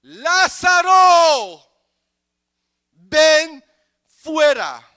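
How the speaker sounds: very high, drawn-out commanding shout; great loud cry. Acted. Jesus calls Lázaro out of the tomb, great loud cry. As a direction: bellow it out slowly, calling a dead man out. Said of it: "clamó a gran voz".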